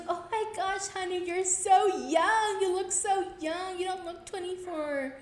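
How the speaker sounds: lightly voiced sweet older lady fussing; gushing lady. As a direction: coo over her like a doting auntie.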